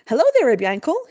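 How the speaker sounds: high, hearty greeting call. A narrator's reading. Maggid greets the approaching Yankel, calling out. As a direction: hail someone brightly from a few steps off. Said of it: "he called".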